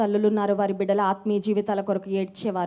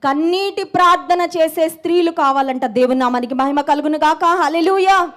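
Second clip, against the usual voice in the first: full-force preaching; 3 semitones higher, 6 dB louder than usual. Real, not acted. Preacher calling women to tearful prayer, full-force preaching. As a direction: preach it out, building to a louder hallelujah.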